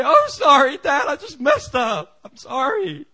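high, loud, hammed-up sobbing plea; mock sobbing apology. Preacher mocks a son sobbing to his father, hammed-up sob. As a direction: overact a blubbering son. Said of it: "start to cry and sob".

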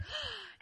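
high, sharp mock gasp; offended gasp. Worker nagged to drink water, mock-offended gasp. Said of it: "so offended".

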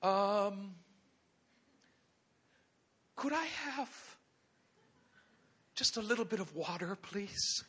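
high, soft, halting plea; timid Elijah. Acted. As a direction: ask meekly with long hesitations. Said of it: "all he could muster the strength to ask".

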